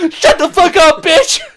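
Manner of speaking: gasping loud yell. Real, not acted. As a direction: burst out shouting. Startled outburst with gasp.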